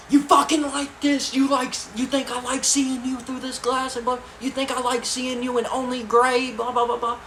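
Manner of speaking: high-pitched, loud scolding rant; mock furious father, running on in a high voice; imitated angry dad. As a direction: rant at your kid through glass. Dad angry through the glass.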